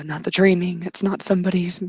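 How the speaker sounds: ordinary talk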